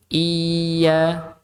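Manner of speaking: "Iya" with a long held "ii" gliding into "ya"; flowing ya. Shown as a demonstration. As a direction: stretch the start of "Iya". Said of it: "mengalir. Kayak huruf ya sama".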